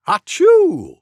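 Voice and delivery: big loud acted sneeze. Storyteller sneezes the title to the children. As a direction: read the title as a real sneeze. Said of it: "Let's begin".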